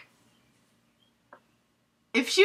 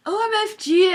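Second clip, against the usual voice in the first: mock gushing; 11 semitones higher, 3 dB louder than usual. Ebony reacts after the song.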